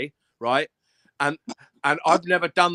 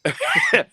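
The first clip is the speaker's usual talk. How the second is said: high burst of laughter. Real, not acted. Laughing at chat.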